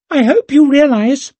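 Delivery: very high, loud squeak; squeaky voice. A narrator's reading. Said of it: "he squeaked".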